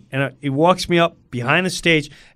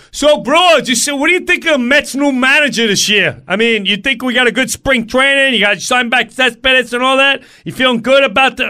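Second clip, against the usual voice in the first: loud, high chummy bro voice; hammed-up loud, high chatty bro; chatty stagehand; 7 semitones higher, 4 dB louder than usual. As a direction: pester him like an eager buddy.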